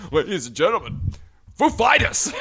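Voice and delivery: loud, high Walken announce, Foo flung up; bug-eyed Walken-style band intro; Walken impression. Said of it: "when you watch [name] do it".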